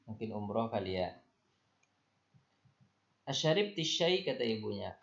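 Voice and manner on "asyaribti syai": higher, chirpy mother voice asking her daughter; the mother checking on her girl; mother voicing a question.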